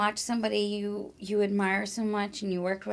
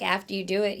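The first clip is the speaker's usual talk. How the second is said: normal talking voice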